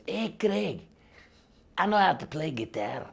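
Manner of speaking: badly faked Scottish accent. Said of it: "I'm not doing a good Scottish accent".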